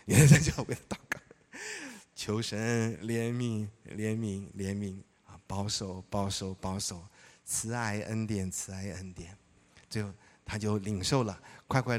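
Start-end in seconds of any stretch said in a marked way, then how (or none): 2.1-9.4 slow, hushed imitated prayer, dropping away; slow earnest prayer. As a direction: pray it slowly and tenderly, fading at the end. Retold prayer for him. (缓慢低沉的模仿祷告)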